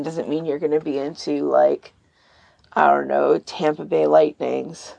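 ordinary talk, nothing marked

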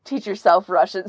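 normal talking voice

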